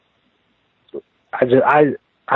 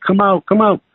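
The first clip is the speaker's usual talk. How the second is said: calling out; 9 semitones higher than usual. Retold or imitated. Calling out to friend at camp.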